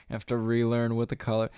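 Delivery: usual speaking voice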